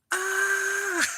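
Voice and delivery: very high, loud sustained scream; full-volume acted shriek; imitated child's scream. Kid showing grandma how loud, imitated scream. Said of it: "do you wanna hear how loud I can scream?".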